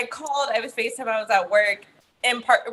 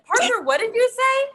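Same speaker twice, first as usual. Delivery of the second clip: mock stern mother; 12 semitones higher than usual. Mother retells confronting her son, high mock-stern question. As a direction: demand an answer, pitched well up.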